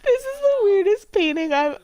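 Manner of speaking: high and loud through laughter; laughing disbelief. Real, not acted. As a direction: crack up mid-sentence. Laughing at the painting.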